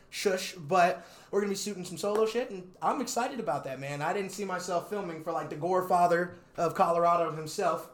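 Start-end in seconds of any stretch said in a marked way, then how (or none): none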